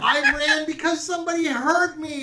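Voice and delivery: put-on high, upset child voice; upset little girl. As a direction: scold them like a hurt kid. Girl character berates the party.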